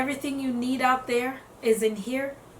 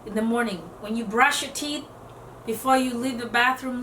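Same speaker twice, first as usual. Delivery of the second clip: ordinary talk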